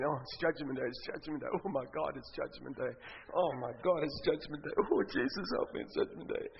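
very soft, low anxious repeating; nervous muttering. Acted. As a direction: fret under your breath while waiting. Waiting nervously in judgment queue.

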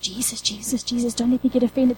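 soft, pleading murmur to Jesus; a quiet retold plea, near a hush; hushed prayer. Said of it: "and I went".

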